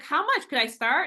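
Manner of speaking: high, loud eager ask; lightly voiced eager self; eager question. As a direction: chirp it out curiously. Asking God about price.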